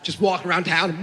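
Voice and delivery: ordinary talk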